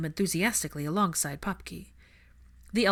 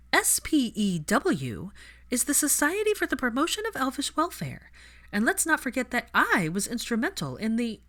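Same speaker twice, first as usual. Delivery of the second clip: heroine's voice pitched higher and a bit louder; the heroine lecturing proudly on elf rights, higher; spelling it out in the heroine's higher voice; heroine voiced higher; 7 semitones higher, 3 dB louder than usual. A narrator's reading.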